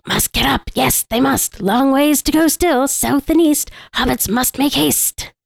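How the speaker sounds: high, urging creature voice with drawn-out words; Gollum. Acted. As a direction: chivvy along as a strange little creature. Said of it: "Oh, that's what this one is".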